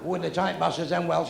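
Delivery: ordinary talk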